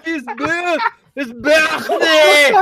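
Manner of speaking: very high, drawn-out mock Scots; big overblown Scottish finish; butchered Scottish accent. Ending the label reading amid laughter, high Scottish accent. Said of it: "Wow, that was brutal".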